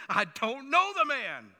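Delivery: loud, slow sworn denial with a heavy "know"; swearing denial. Retold or imitated. Peter swearing to bystanders he doesn't "know" the man, loud and slow. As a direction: bark out the oath that you don't "know" the man.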